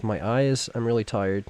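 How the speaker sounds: ordinary talk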